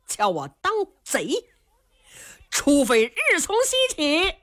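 indignant. Acted. 程咬金 angrily defends himself.

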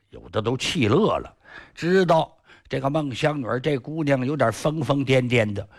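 plain reading voice

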